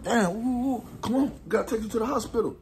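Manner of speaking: high, fast, urgent father rushing him. Retold or imitated. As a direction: hurry him to the hospital. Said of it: "So my pop's like".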